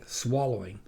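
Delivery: plain reading voice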